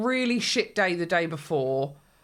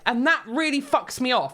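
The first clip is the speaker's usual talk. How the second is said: ordinary delivery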